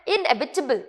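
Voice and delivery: इनएविटेबल with a short "tibul" ending; correct pronunciation. Shown as a demonstration. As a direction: say इनएविटेबल as "in-EV-i-tuh-bul". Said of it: "किस तरीके से pronounce करना है". (इनएविटेबल को "इन-एविटिबल" जैसा बोलें)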